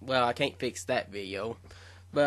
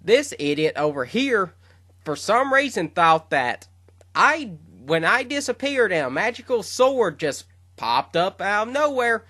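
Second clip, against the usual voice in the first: much higher and louder, building in scorn; exasperated rant; 8 semitones higher, 5 dB louder than usual. Retold or imitated. Elder Kai complaining to Gohan about Shin, in exasperated anger. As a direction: rant at the idiot who never figured it out. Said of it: "Elder Kai is not happy".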